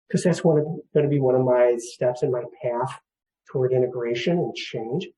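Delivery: ordinary talk, nothing marked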